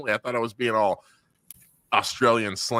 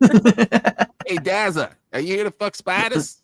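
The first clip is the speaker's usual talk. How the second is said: higher hammed-up Aussie call, hey Dazza; louder mock Australian shout across the pitch; Australian accent; 8 semitones higher, 6 dB louder, slower than usual. Mate teasing Dazza, hammed-up Australian accent. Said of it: "My Australian accent turns into".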